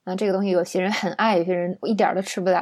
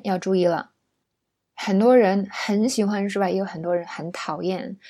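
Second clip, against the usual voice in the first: usual speaking voice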